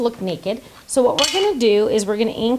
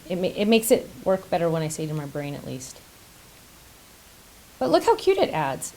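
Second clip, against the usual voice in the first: usual speaking voice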